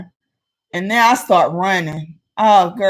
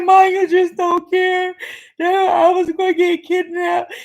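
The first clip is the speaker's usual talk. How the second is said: put-on crying child; 10 semitones higher than usual. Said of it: "And I was just crying".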